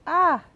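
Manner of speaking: short, high, loud "ah"; real yelp cutting off the talk; sudden exclamation. Real, not acted. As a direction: let out a quick high cry. Startled as recording suddenly breaks off.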